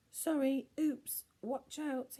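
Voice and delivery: high, slow flustered apologies; nervous Norman. A narrator's reading. Norman stepping on fingers, flustered apologies. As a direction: fumble through the apologies. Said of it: "he muttered".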